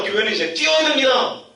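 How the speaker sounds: imitating a fired-up preacher; preacher proclaiming. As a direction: escalate like a preacher.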